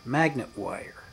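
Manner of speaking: ordinary talk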